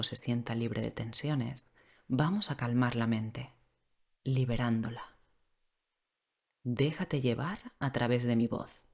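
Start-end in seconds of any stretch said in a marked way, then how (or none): none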